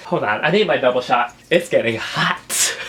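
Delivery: normal talking voice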